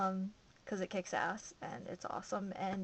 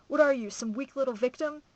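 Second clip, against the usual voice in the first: loud, higher taunting challenge; relentless goading; 4 semitones higher, 10 dB louder than usual. A narrator's reading. Metz taunting the worn-out narrator, loud and relentless. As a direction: needle them hard, giving no let-up. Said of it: "She never let up".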